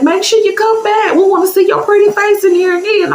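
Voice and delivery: high, sugary parting call; sweet church lady. Retold or imitated. The church lady calls to the teller as she leaves, high and sweet. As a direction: wave her off sweetly.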